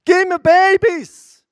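high, loud crying out to God; loud desperate cry, slow on the last plea; shouted prayer. Retold or imitated. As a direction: scream it like your life depends on it. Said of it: "Da habe ich zu Gott geschrien".

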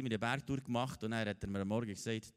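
ordinary talk, nothing marked

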